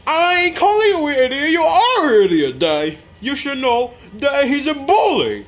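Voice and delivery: very high, loud yelled insult; yelling. Acted. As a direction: shriek it at dad. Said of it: "stop yelling at me".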